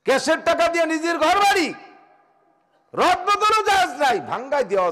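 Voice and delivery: high, loud preaching cry; fiery sermon. Acted. Preacher thundering at the mosque committee, loud and high. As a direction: lift the voice and hammer it at the crowd. Waz preacher at full force.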